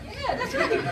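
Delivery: normal talking voice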